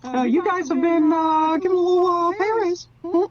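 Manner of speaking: very high cartoon studio boss; squeaky, folksy executive buttering up the staff; Mick. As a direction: pitch it way up, chummy and hesitant. Plays Mick offering pay raise.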